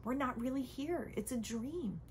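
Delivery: ordinary talk, nothing marked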